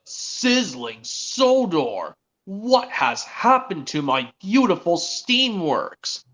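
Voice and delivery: very slow, drawn-out cartoon dismay; theatrical slow lament; Victor. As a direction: stretch each word in a put-on character voice. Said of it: "Victor's reaction was".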